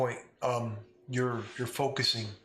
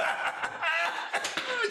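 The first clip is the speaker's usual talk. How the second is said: manic laugh. Acted.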